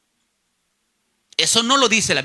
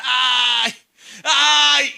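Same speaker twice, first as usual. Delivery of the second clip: long "ay" held at raised pitch; acted cry of pain; 3 semitones higher, 4 dB louder than usual. El hermano Panchito gritando de dolor, acted pained wail. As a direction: imitate a dying man crying out in agony. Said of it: "Y empezaba a gritar".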